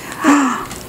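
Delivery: sharp soft gasp of wonder; delighted gasp. Real, not acted.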